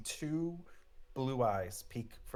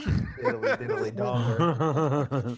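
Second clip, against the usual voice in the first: drawn-out, louder laughing nonsense; laughing joke; 4 semitones higher, 10 dB louder, much slower than usual. Real, not acted. Game master teasing a player over a word, laughing. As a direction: let the silly words break into laughter.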